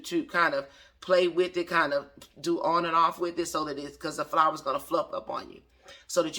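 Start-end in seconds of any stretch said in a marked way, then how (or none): none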